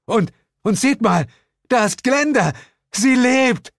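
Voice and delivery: high, loud joyful call; excited cry. A narrator's reading. Narrator spots Glenda alive, excited shout. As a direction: point her out, thrilled. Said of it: "rief ich".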